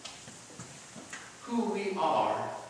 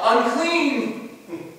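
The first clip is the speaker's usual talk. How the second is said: higher, projected warning cry; acted yell; 7 semitones higher, 3 dB louder, much slower than usual. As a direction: call it out as a leper warning people away. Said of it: "he was supposed to yell".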